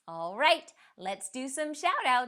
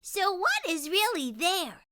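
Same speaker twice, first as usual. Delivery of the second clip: put-on a young girl asking her mom, lifted higher; curious little girl, pitched up; Alina's voice; 7 semitones higher than usual. Daughter Alina asking her mom, curious girl voice. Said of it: "Alina asked her mom".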